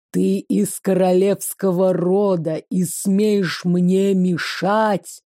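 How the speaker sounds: slow, menacing demon voice. Acted. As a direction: growl it slowly like a creature from the abyss. Demon growls at the heroine.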